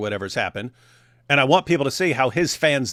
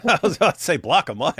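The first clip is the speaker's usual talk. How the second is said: laughing; 9 semitones higher than usual. Real, not acted. Laughing at own misreading.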